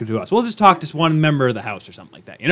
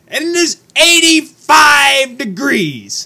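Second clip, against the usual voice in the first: exasperated; 10 semitones higher, 4 dB louder, much slower than usual. Real, not acted. Complaining about the heat.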